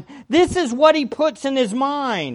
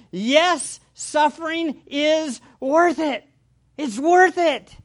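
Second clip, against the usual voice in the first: emphatic yes, pitched a little higher; 4 semitones higher, slower than usual. Acted. Preacher voicing Paul's answer to the congregation, emphatic. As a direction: affirm it with firm conviction. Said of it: "Paul himself implies emphatically".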